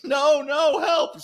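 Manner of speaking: high panicked cry of no, no, help; mock screaming for help. Retired cop imitating a beaten thief screaming for help. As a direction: yelp it like a man falling down stairs. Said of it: "you know, screaming".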